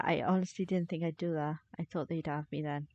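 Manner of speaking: ordinary delivery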